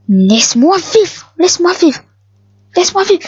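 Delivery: high whiny plea climbing with each repeat; mock pleading. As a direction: beg for your life in jest. Begging an attacking player in game.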